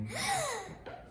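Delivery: high, mimicked gasp; shocked gasp. Child imitating a girl who saw the injury, shocked gasp. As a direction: act out a girl's shocked gasp. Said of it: "went up to the stairs and went".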